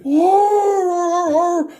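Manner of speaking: loud, high, drawn-out dog howl; dog roaring. Acted. Police dog lunging at the passenger, roaring loudly. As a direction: let rip a long mock dog roar. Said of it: "The dog immediately".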